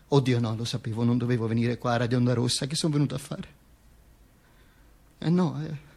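low, soft grumble to himself; muttered aside. Acted. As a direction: drop right down, as if regretting coming. Steps aside, muttered self-doubt. (borbottio sommesso tra sé)

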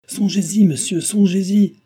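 high, loud urging; shouted warning. A narrator's reading. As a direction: shout a warning after him. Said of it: "cria le maître de poste".

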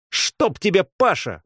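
high-pitched outcry; exasperated cry. A narrator's reading. Girl cursing a friend who blocks her, exasperated cry. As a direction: cry out at his interference. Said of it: "вскрикнула Рита".

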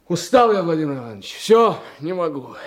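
the tired detective blurting out to his mentor; worn-out outburst. Acted. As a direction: let it burst out, then sag on the name. Detective breaks down to his boss.

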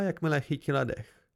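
ordinary delivery, nothing marked

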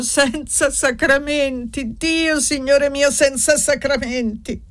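high, slow, moaning lament; wailing cry. A narrator's reading. Widow running downstairs in the dark, wailing. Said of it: "gemendo e gridando".

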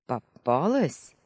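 slow triumphant exclaim; drawn-out gotcha call. Acted. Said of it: "воскликнул мужчина".

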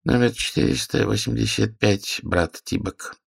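bored clerk calling a number; flat officious bureaucrat. A narrator's reading. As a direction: drone the file number. Clerk calls out next number.

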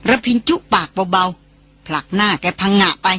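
ordinary delivery, nothing marked

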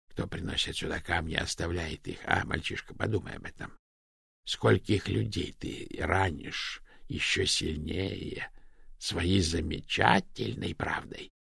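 soft, slowing, half-voiced murmur; whistling whisper. A narrator's reading. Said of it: "заговорил свистящим шёпотом, голос отдался эхом".

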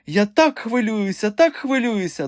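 high-pitched nervous flutter; agitated. A narrator's reading. Directress awaiting the president, agitated. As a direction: fret aloud at a high pitch. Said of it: "додала вона вголос".

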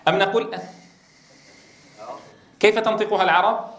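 normal talking voice

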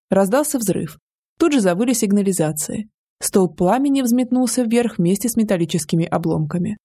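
plain reading voice

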